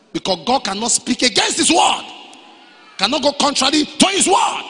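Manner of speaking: loud, climbing to a shout on "word"; full-force preaching. Real, not acted. Preacher insisting God cannot contradict his word, full-force preaching. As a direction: hammer the point home.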